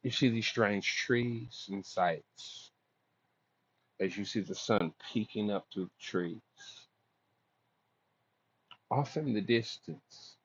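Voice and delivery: slow, soft storyteller voice; scene narration. Acted. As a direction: paint the scene slowly and hushed. Game master's scene setting.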